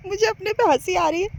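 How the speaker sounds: normal talking voice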